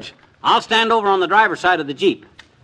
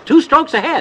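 higher, gloating repeat; gleeful boast; 7 semitones higher, 3 dB louder than usual. Acted. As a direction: crow about the lead.

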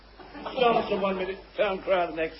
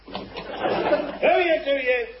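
laughing; 11 semitones higher, 6 dB louder, much slower than usual. Acted. Courtier giggling to the queen.

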